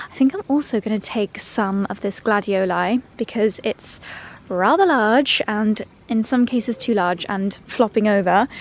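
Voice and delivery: ordinary delivery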